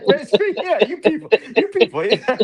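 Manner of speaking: high, giggling yeah, you people; laughing. Real, not acted. Host cracks up repeating the joke to friends, laughing. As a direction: laugh through the punchline.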